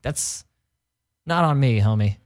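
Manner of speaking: normal talking voice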